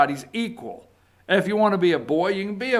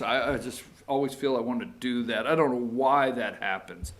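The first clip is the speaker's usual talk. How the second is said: ordinary delivery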